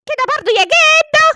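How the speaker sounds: put-on high, loud whining kid; whiny child. Granddaughter scolding grandpa for leaving mum behind, high whine. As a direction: whine like a hurt little girl.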